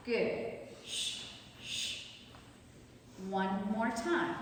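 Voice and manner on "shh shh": shushing. Real, not acted. Shushing the class mid-exercise.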